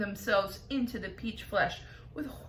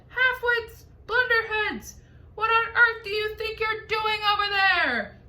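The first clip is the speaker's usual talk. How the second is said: yelling insult; 12 semitones higher, 8 dB louder than usual. A narrator's reading. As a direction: hurl the insult across the sky. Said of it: "he yelled".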